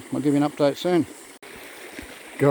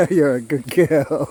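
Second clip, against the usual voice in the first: slow, tender praise for the dog; gentle praise; 3 dB louder, slower than usual. Real, not acted. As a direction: soothe the dog slowly after the yell. Praising dog after yell.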